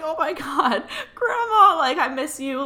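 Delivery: high, loud cry of recognition with a laugh in it; overwhelmed, laughing, high-pitched exclamation; emotional outcry. Retold or imitated. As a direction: call out to a missed loved one, voice jumping up. Acts out overwhelmed reunion with grandma.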